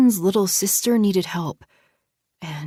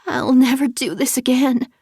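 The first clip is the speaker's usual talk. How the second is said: high, tearful vow; sobbing; 6 semitones higher, 6 dB louder than usual. Acted. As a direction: sob the promise out. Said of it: "body shuddered with sobs".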